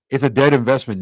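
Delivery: ordinary talk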